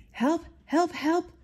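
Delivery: three high, loud cries; frantic calls. Acted. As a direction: call out in alarm as the fire chief. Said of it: "called out the fire chief".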